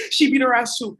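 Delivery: high burst of laughter; laughing hard. Real, not acted. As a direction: laughs out loud while telling it. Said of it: "laughs then says".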